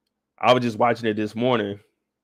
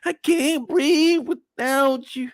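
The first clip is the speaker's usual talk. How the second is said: much higher, slow begging voice; mock pleading lover; 11 semitones higher than usual. Q begging Shelby not to leave him, high slow hammed-up plea. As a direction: ham up a desperate proposal. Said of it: "He said".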